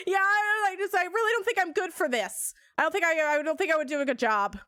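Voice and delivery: high, rushed, panicky pleading; panicked rambling. Retold or imitated. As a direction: babble it out in a panic. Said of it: "talking, like, a mile a minute".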